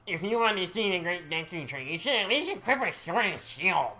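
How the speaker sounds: put-on high, loud bratty kid voice; Mido voice acting. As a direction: sneer it like a cocky forest kid. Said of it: "Do your best voice acting".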